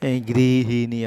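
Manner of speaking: গৃহিণী drawn out slowly, each syllable গৃ হি ণী distinct; teacher modelling গৃহিণী slowly for the class; careful pronunciation. Shown as a demonstration. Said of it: "এভাবে উচ্চারণটা হবে".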